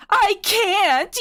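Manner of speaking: high, loud cry; anguished teen yell. Acted. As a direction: yell it back in despair. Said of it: "you yelled back at him".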